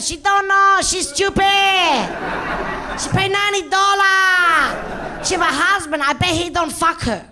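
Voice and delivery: high, loud mock Korean accent; Korean masseuse impression. Masseuse mocking the client to her mate, high loud accented voice. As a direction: gossip rudely in a broken-English accent. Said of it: "she's saying something a bit like this".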